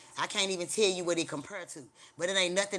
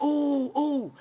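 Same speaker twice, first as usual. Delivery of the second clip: high, louder startled ooh ooh; high mock-startled ooh; shocked ooh; 8 semitones higher, 4 dB louder than usual. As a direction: react like you just saw her in hell. Sees the lady.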